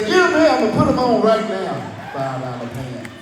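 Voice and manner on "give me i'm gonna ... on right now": much higher, louder and fast; excited shout. Retold or imitated. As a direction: blurt it out thrilled. Excited at five-dollar price.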